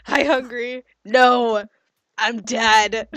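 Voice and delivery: loud, laughing corny dad pun; mock dad joke.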